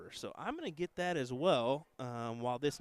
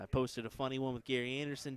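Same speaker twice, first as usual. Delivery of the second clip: ordinary talk, nothing marked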